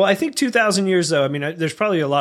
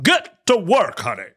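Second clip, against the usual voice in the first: mock Franklin husband; 3 semitones higher than usual. Playing Franklin to wife.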